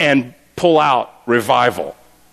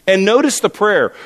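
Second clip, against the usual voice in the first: normal talking voice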